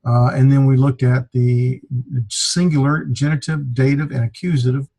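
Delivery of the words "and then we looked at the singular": ordinary talk, nothing marked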